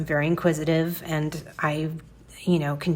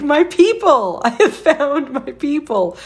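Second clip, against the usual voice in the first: high, loud laugh of delight; 9 semitones higher, 5 dB louder than usual. Retold or imitated. Telling viewers how the clerk's OCD admission delighted her, laughing. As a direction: crow it like you found your tribe. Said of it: "now I'm like".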